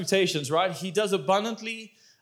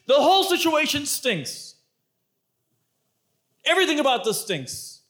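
higher, a hint of exasperated Martha; Martha complaining; 7 semitones higher than usual. Martha tells Jesus he is too late, mildly exasperated. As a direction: pile on the complaint. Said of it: "She's like".

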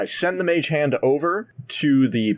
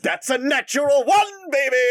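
excited yell; 13 semitones higher than usual. Real, not acted. Celebrating failed roll.

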